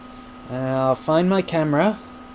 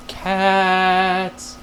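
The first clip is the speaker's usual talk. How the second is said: one long, held, playful "caaats"; long drawn-out word; 3 semitones higher than usual. Pretend. Vlogger playfully answering dogs or cats, drawn out. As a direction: stretch CATS right out.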